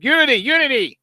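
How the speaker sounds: high-pitched nasal chant; nasally teammate impression. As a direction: mimic a very nasally huddle cheer. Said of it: "Junior has a very nasally voice".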